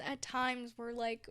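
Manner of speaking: normal talking voice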